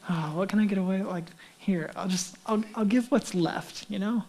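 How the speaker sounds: soft, offhand mumble of someone giving leftovers; quietly shrugging it off; half-hearted giver. Acted. Imitates half-hearted givers cutting corners.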